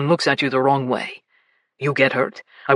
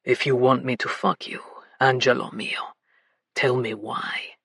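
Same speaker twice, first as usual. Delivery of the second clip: low and coaxing; 10 semitones lower than usual. A narrator's reading. Vito coaxing Bella for the truth, low and coaxing. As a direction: coax her gently, man's low voice. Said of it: "His voice was low and coaxing".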